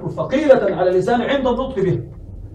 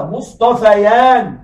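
slow demo; much slower than usual. Shown as a demonstration. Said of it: "ما مثنى مصطفى؟".

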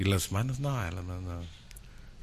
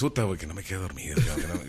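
tired mumble; 7 semitones lower, 7 dB quieter, faster than usual. Retold or imitated. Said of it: "ya el segundo dices".

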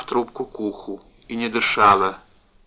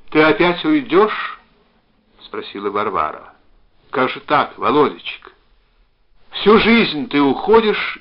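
raised, louder pleading voice; pained reproach; 5 semitones higher, 6 dB louder than usual. A narrator's reading. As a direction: reproach a loved one who keeps leaving. Reproachful woman voiced on phone.

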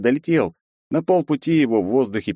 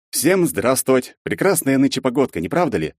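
mock formal greeting; 6 semitones higher than usual.

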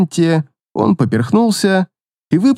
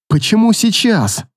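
higher, moaned despair; inner groan; 6 semitones higher than usual. A narrator's reading. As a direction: groan it inwardly. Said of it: "мысленно простонала она".

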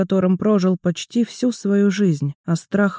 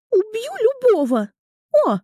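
put-on high little girl voice; squeaky, playful small girl; Mora's voice; 14 semitones higher than usual. Mora speaks, higher voice.

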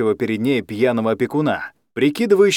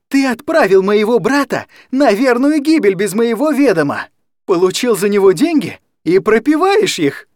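high, loud furious yell; 9 semitones higher, 5 dB louder than usual. Acted. Anya yelling at the guardian who sold her brother. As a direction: scream the accusation across the room. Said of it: "орала Аня на всю комнату".

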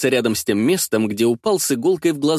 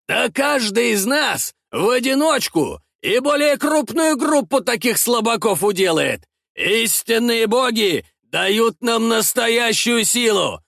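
a mocking shout, much higher, slowing on the boast; boastful thug; 9 semitones higher than usual. Mustached thug taunting the heroes, mocking shout.

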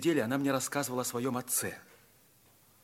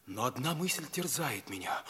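usual speaking voice

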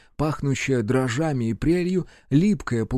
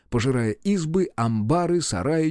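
ordinary delivery

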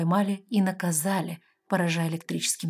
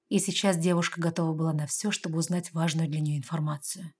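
ordinary delivery

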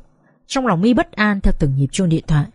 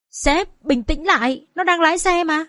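higher-pitched, hurried plea to the boss; urgent interjection; 7 semitones higher, slower than usual. Acted. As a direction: urge the boss to calm down. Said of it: "Kiên vội lên tiếng".

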